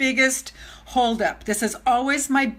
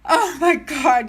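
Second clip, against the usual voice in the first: slow, higher, louder gasp of joy; real overjoyed amazement; 4 semitones higher, 5 dB louder, slower than usual. Real, not acted. Overcome as friends join the stream, real amazement. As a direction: draw it out, overwhelmed with delight.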